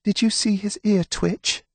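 put-on a high, anxious young woman; Delphine's voice. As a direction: Delphine fretting over the mule, high and anxious. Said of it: "Delphine said".

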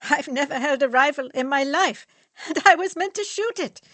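loud, high, disbelieving complaint; indignant outburst. Acted. Fritz to friends, aggrieved about the rifle drill. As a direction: protest it, voice climbing.

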